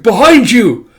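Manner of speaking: high, loud cry of warning; urgent warning shout. Acted. Character warning a companion of the attacking beast, urgent shout. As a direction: yell it to save someone.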